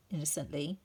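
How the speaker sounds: usual speaking voice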